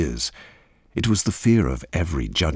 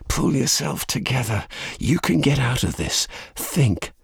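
tense, higher-pitched pep talk to oneself; urgent self-talk; 9 semitones higher than usual. A narrator's reading. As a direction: urge yourself to get a grip, without raising volume. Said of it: "she told herself".

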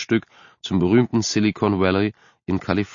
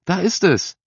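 much higher, delighted cry; 11 semitones higher than usual. Acted. Mother spots the new house and cries out in delight. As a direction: squeal with joy at the first sight. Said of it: "Entzückensschrei seiner Mutter".

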